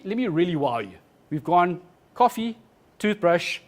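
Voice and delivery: normal talking voice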